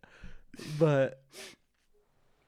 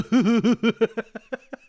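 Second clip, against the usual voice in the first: loud laughter; 9 semitones higher, 10 dB louder than usual. Real, not acted. Laughing after the prank story.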